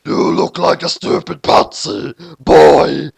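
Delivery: put-on loud sneering snarl on boy. Blood snarling at Dark. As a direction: spit the insult. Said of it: "Blood snarled".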